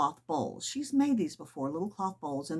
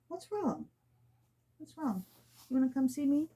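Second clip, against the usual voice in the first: cooing to the cat; 7 semitones higher, 3 dB quieter than usual. Real, not acted. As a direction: coo gently to an upset kitty. Cooing to her upset cat.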